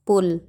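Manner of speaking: पुल with a short, light u and no push; short unstressed u. Shown as a demonstration. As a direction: say पुल with a brief u. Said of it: "शब्द पे जोर नहीं देते हैं".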